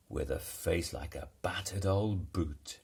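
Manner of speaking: ordinary delivery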